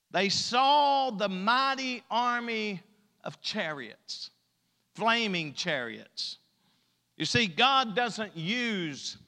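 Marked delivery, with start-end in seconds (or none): 0.1-6.4 slow preaching. Real, not acted.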